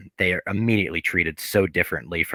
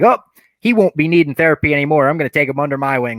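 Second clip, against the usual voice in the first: smug douchebag; 6 semitones higher, 5 dB louder, faster than usual. Retold or imitated. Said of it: "walks in like such a douchebag".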